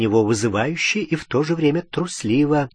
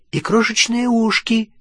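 rattling on; 10 semitones higher than usual. A narrator's reading. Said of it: "тараторила Полумна".

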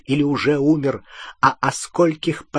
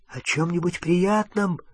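hoarse, softer disbelieving echo; 5 semitones higher, 4 dB quieter than usual. A narrator's reading. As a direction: rasp the words back. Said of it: "хрипло повторил он".

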